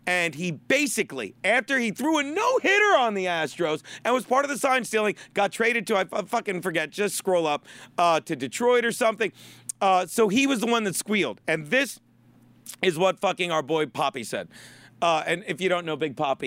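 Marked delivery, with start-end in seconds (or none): none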